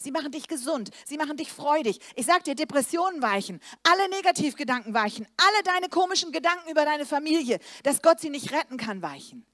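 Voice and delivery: loud, high, rousing sermon voice; fervent preaching. Acted. Preacher rousing the congregation, loud and fervent. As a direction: preach it with full force to the crowd.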